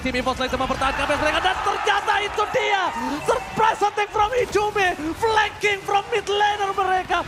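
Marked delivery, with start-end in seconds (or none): 1.4-7.2 high, slow dramatic reveal call; hype announcer. Real, not acted. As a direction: draw out the big reveal. Caster reveals a surprise flank. (pengumuman dramatis komentator)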